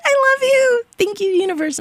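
very high, squealed affection; giddy high-pitched gush; gushing joy. Real, not acted. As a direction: squeal your love to the universe. Gushes thanks to the universe.

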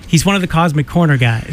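ordinary talk, nothing marked

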